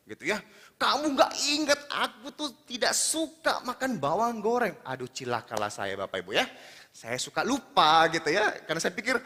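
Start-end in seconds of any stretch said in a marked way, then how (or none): 0.8-4.7 mock complaining wife.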